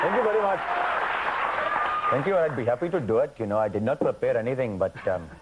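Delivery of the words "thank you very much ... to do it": put-on high Indian-accented shopkeeper thanks; bright, high Indian accent, eager to please; playing Apu thanking the host, Indian accent. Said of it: "I wanna hear some Apu".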